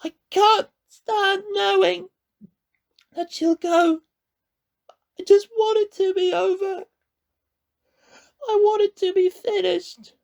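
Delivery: put-on a desperate boy pleading; anguished boy. A narrator's reading. Connor voiced in grief.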